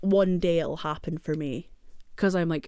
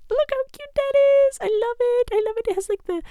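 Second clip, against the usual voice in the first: much higher, louder gushing; excited squeal; 6 dB louder than usual. Real, not acted. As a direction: squeal with delight over the cap. Gushing over her new cap.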